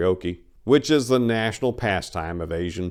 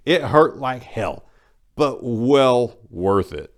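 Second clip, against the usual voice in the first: pained drawl; 3 semitones higher, 5 dB louder, much slower than usual. Retold or imitated. Recalling the piercing pain.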